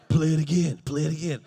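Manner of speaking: low, loud mock plea; friend's voice. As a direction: drop low and push it.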